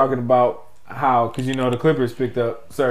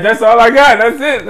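put-on Jamaican accent; 8 semitones higher, 7 dB louder than usual. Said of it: "Jamaican African accent".

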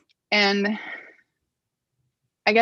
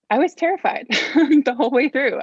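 laughing; 6 semitones higher, 5 dB louder than usual. Real, not acted. Laughing while admitting fear.

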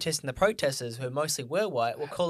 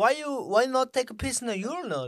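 higher, louder mock Russian accent; 9 semitones higher, 3 dB louder than usual. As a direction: put on a jokey Russian accent, pitched up. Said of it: "Why'd I go Russian?".

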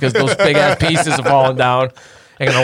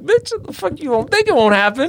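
exasperated; 11 semitones higher than usual. Retold or imitated. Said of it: "I'm like".

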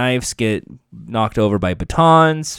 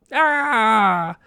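high, drawn-out "aah"; hammed-up scream at being cut; mock pain scream; 9 semitones higher than usual. Recapper voicing Barbara slashed in the leg, mock scream.

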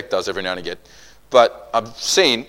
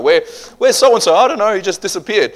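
high, raised voices asking around; mock puzzled townsfolk, pitched up; puzzled bystanders; 9 semitones higher, 5 dB louder than usual. Acting people puzzled by disappearance.

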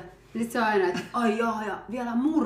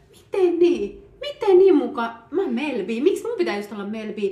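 high, loud offended protest; indignant protest; 4 semitones higher, 5 dB louder than usual. Retold or imitated. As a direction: push back, insulted. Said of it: "Ja se tunne". (loukkaantunut, kovaääninen vastalause)

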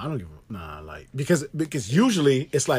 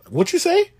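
much higher, offended what you say; mock confrontation; 10 semitones higher, 4 dB louder, slower than usual. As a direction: play being challenged, mock outrage.

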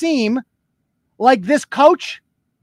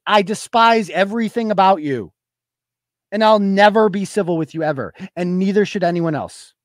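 ordinary delivery

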